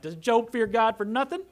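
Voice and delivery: high, loud sarcastic challenge; sarcastic Satan. Acted. Satan answering the Lord about Job, loud and sarcastic. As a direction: sneer the question as Satan, pitch jumping up. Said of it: "I can just hear the sarcasm".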